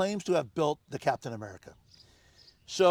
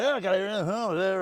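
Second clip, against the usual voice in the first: dismissive; 3 semitones higher, faster than usual. Retold or imitated. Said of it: "He's trying to".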